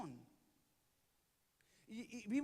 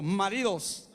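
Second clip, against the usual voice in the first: strong call; 3 semitones lower, 8 dB louder, slower than usual. Shown as a demonstration. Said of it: "A ver, diga conmigo".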